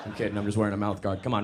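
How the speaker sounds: ordinary delivery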